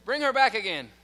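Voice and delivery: a judge ordering the bailiff, loud and firm; angry command. Retold or imitated. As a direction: bark it out loud. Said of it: "he says".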